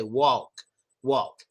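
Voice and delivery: "walk" low-pitched, with the L made by the tongue; learner's careful "walk". Shown as a demonstration. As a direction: use the tongue for an audible L in "walk". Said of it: "use your tongue and say".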